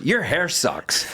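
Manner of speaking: deadpan comeback. Retold or imitated. Rufus's comeback.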